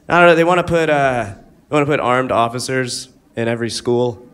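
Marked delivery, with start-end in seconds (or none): none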